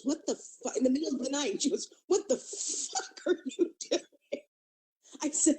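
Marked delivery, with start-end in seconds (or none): 0.0-0.8 higher, cut-short retold disbelief; mock shock. Retold shocked partner walking in. 2.1-4.0 mock appalled shock. Retold shocked partner's outburst.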